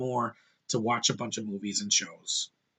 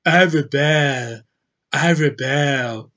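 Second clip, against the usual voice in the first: mock British accent, drawn out slowly; slow, exaggerated parody of a British actress; Jyn Erso; 3 dB louder, much slower than usual. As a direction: stretch each word in a put-on British accent. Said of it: "Like, Jyn Erso going".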